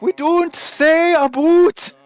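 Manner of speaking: high, slow, drawn-out mock yell; tipsy coworker yelling at the table, high and drawn out; drunken yell. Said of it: "you know, like".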